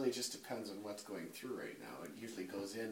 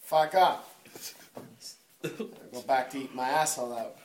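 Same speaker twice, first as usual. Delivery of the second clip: loud, brash Brooklyn comic voice; Brooklyn accent; 2 semitones higher, 13 dB louder than usual. Retold or imitated. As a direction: tell it like a Brooklyn stand-up, loud. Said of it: "put on a Brooklyn accent".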